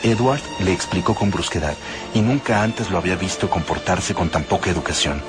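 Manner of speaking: ordinary delivery, nothing marked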